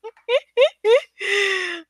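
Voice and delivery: high giggle at himself; embarrassed laugh. Real, not acted. Host laughing at his own cheer, embarrassed. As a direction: laugh off the outburst. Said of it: "I'm so sorry with that one".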